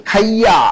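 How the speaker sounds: খাইয়া said in full as "khaiya"; non-standard pronunciation. Shown as a demonstration. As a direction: stretch খাইয়া out with the "ai-ya" ending. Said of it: "এটা কিন্তু প্রমিত উচ্চারণ না".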